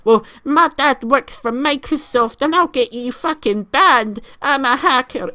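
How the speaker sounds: high, slow, loud mocking kid voice; hammed-up imitation of a bragging little kid online; mock kid hacker. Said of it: "you come out with the excuse".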